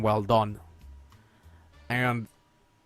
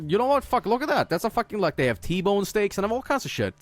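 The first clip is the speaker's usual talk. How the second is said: much higher, thrilled exclamation at the menu; excited outburst; 8 semitones higher than usual. Retold or imitated. Son spotting T-bone steaks on the menu, thrilled and much higher. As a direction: squeal it like you just found treasure. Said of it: "and I'm just like".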